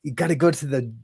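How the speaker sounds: mock his acting tough; mock tough-guy voice. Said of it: "this weird thing with his mouth and his voice".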